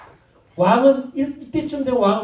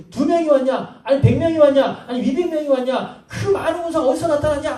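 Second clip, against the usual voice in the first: mock alarm, escalating numbers; panicked enemy soldiers; 7 semitones higher than usual. Preacher voicing the confused Philistine soldiers.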